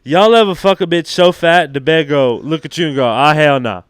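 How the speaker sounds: loud, high rowdy crowd work; comic voice. Acted. Comic loudly hitting the crowd with a raunchy bit. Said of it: "that would kill".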